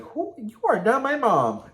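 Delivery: very high, loud mock outrage; indignant comeback. Vlogger retelling the retort to being counted at, indignant. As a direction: shoot up high in offended disbelief.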